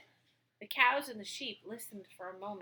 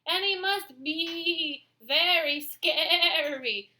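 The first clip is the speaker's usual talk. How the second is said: stammered; 6 semitones higher, 4 dB louder, slower than usual. Acted. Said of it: "the sheep stammered".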